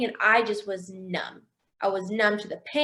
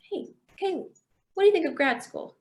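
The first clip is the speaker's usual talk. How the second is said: casual nudge in a higher voice; gently suggesting; 6 semitones higher than usual. Retold or imitated. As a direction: float the idea lightly, high-pitched. God's gentle nudge, retold.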